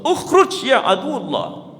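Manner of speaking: high, slow stern command; Prophet commanding the jinn. Acted. Prophet ordering the jinn to leave, commanding high and slow. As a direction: order the spirit out, drawn out. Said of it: "kata Rasulullah".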